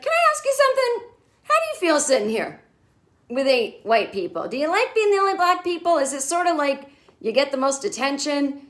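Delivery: high, fast nosy questions; pry with cringe eagerness; awkward Larry. Retold or imitated.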